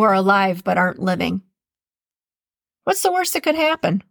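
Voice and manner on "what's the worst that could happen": upbeat. Shown as a demonstration. Upbeat motto.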